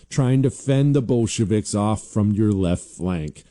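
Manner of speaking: normal talking voice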